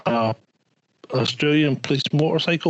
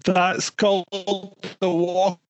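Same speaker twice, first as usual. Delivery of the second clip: mocking taunt; 5 semitones higher, much slower than usual. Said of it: "the guy's shouting at him".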